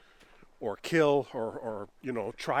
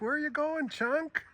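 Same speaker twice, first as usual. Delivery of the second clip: much higher, soft voicing of a curious cat; cat asking Chunk where he's going, squeaky and higher; 12 semitones higher, 5 dB quieter than usual. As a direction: give the cat a tiny high voice. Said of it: "She's like".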